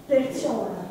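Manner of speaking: ordinary talk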